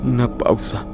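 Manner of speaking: ordinary delivery, nothing marked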